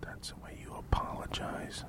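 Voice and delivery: whispered off-mic coaching; very soft, secretive whisper. Real, not acted. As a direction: whisper it privately to your co-host. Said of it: "You're whispering".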